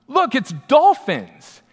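high, slow, wide-eyed announcing of the fins; drawn out like spotting something wonderful; excited mother-in-law. Retold or imitated. Mother-in-law pointing out dolphins to the family, high and slow.

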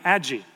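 Aggie said as "ADJ-ee"; soft-g mispronunciation. Retold or imitated. Dad quoting toddler's reply, Aggie with soft g. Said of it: "she'll say".

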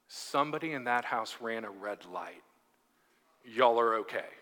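low, soft deadpan; straight-faced officer. Retold or imitated. Police officer reassuring worried neighbours, straight-faced deadpan. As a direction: imitate an officer giving a completely straight-faced answer. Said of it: "with a completely straight face says".

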